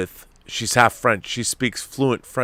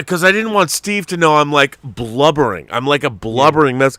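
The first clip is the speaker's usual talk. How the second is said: usual speaking voice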